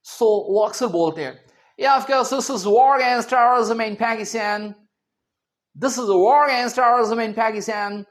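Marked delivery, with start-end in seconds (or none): 1.8-8.0 put-on slow, heavy American accent; put-on presidential American drawl, "Pækistæn"; Trump. US president giving a speech, heavy American accent. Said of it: "काफी heavy सा accent होता है उनका".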